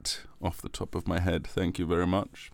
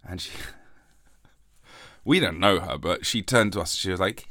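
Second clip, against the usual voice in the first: ordinary talk, nothing marked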